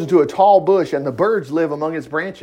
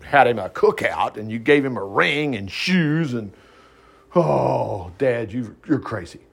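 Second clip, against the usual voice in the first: prodigal's brother; 6 semitones lower than usual. Acted. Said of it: "We can't be like the prodigal's brother".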